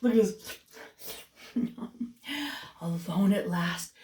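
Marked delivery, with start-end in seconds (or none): 1.5-2.1 soft laugh. Real, not acted. Reader laughs at picture.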